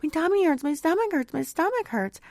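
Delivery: imitating her son. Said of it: "He's like".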